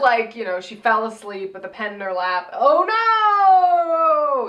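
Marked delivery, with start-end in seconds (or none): 2.5-4.5 mock dismay. Real, not acted. Reacting to the odd outfit.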